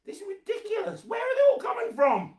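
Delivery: high squeaky outraged disbelief; exaggerated shock at the screen; mock disbelief. Mock shock at hearts flooding.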